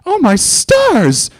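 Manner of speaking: very high, slow, loud gasp of horror; Rarity crying out as Pinkie falls; horrified cry. A narrator's reading. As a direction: cry out in shock. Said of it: "Rarity cried in horror".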